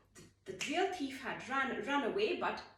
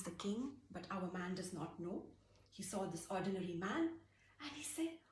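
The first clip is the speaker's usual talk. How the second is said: hushed narration; 8 dB quieter than usual. A narrator's reading. Narrator drops to whisper.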